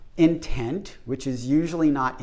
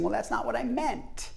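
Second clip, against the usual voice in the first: mock apologizing; 7 semitones higher, 4 dB quieter than usual. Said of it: "And now they're apologizing".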